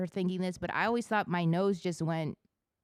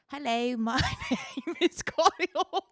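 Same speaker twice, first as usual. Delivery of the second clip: put-on British accent; 8 semitones higher, 7 dB louder than usual. Mock British accent introducing himself as Kyle. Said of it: "Like British".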